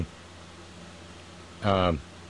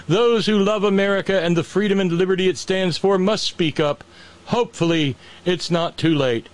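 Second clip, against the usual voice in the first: high, grandiose mock proclamation; mock pompous reading; 5 semitones higher than usual. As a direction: ham up the patriot speech. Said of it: "here's the best part".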